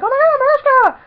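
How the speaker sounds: high, loud squeal of recognition; excited fan scream. Retold or imitated. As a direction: squeal out at spotting your idol. Retold fans calling out to star.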